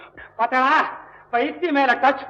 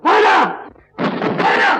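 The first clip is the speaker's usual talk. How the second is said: panicked call through the door; desperate shout; 7 semitones higher than usual. Acted. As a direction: yell her name at the locked door. Calling through a locked door.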